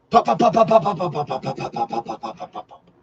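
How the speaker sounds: rapid pops starting high, then fading away; rhythmic pops. Retold or imitated. Imitating rhythmic fryer work.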